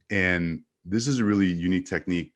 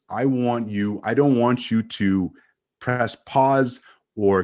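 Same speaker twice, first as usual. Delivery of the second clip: slow, higher, punched word by word with pauses; slow, emphatic; 4 semitones higher, 4 dB louder, slower than usual. Shown as a demonstration. Coach showing how to hold attention, slow and emphatic. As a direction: drive each phrase home deliberately. Demonstrates pace and emphasis for engagement.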